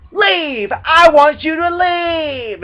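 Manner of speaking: high, loud screamed Leave, slow on the second line; retold scream. The enraged partner screaming at her to get out. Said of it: "And it screams".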